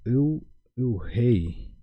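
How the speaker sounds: "u, uhei" drawn out slowly and softly, the u held apart; a teacher slowly modelling "u, uhei"; slow pronunciation demo. Shown as a demonstration. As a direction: break "u, uhei" into slow parts for the class. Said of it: "uhei se pronuncia".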